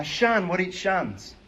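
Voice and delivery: ordinary talk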